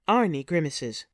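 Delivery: usual speaking voice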